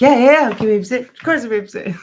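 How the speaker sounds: fast, high, loud over-eager yes; begging to see her old dog, fast and loud. Retold or imitated. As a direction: gush like you can't wait to say yes. Eagerly agreeing to babysit old dog.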